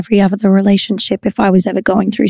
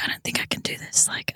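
hushed scared whisper; 16 dB quieter than usual. Retold or imitated. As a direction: breathe it out, nervous. Retelling her fear before filming.

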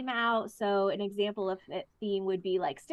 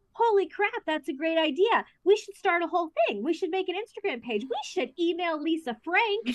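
high, louder burst of eager ideas; retold excitement; 9 semitones higher, 3 dB louder than usual. Retelling her reply to friend's sticker idea, excited. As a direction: gush idea after idea, pitched up high. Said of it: "we were just really excited".

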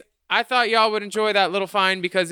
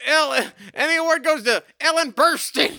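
award announcer voice; 9 semitones higher than usual. Pretend.

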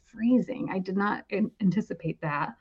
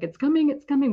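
raised, urgent cry, lightly retold; mild mock yell; 7 semitones higher, 3 dB louder than usual. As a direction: call it out higher, half-acted. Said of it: "I just started screaming".

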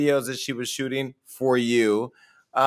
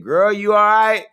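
higher, louder, caring call to a friend; concerned check-in; 7 semitones higher, 6 dB louder than usual. Retold or imitated. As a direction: reach out to a friend who is struggling. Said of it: "I said".

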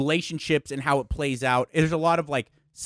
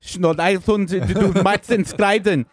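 louder made-up German gibberish; loud mock German babble; 4 semitones higher, 6 dB louder, slower than usual. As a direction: ham up fake German to a colleague. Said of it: "he goes to this woman".

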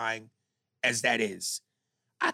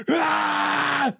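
loud high furious yell; imitated rage scream; 13 semitones higher, 5 dB louder than usual. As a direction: let out a wild enraged yell. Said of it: "he is yelling at the top of it".

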